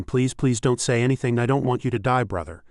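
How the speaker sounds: plain reading voice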